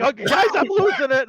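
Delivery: high, loud mock panic; retold mock scream. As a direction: imitate a wizard losing control. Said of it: "A wild mage guy screaming".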